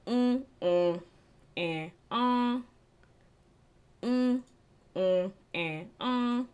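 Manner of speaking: mm, uh, eh mixed in random order; nasal vowels like a singing warm-up; mixed vocal drill. Shown as a demonstration. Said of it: "and then mix it around".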